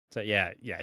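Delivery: ordinary delivery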